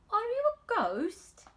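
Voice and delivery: put-on high, startled child asking in the dark; frightened Colin. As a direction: cry out like a startled, sickly boy. Colin meets Mary at night.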